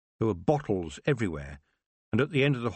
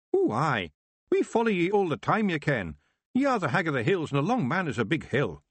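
high Scots wee-man voice, cheerful; a tiny Scottish warrior boasting; Feegle chieftain, proud; 7 semitones higher than usual. Acted. Rob speaks in Feegle voice.

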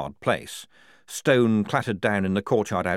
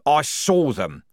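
loud, higher-pitched snarl; 8 semitones higher, 7 dB louder, slower than usual. A narrator's reading. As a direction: snarl the accusation out. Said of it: "snarled Strappy".

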